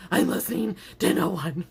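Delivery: loud, mock-defiant; defiant declaration. Fed up with chefs' advice, loud mock defiance. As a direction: declare it stubbornly. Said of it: "And I was like".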